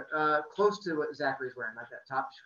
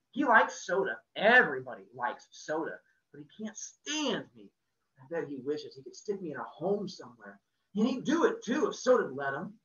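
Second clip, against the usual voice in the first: louder, higher, bitter rant at his brother; teen boy bursting out bitterly about his brother; bitter outburst; 5 semitones higher, 7 dB louder than usual. A narrator's reading. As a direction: spit it out with hurt and resentment. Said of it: "I just burst out bitterly".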